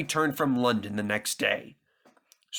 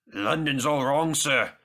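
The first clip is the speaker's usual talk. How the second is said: ordinary delivery, nothing marked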